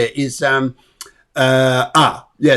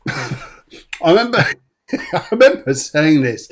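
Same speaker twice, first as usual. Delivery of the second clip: usual speaking voice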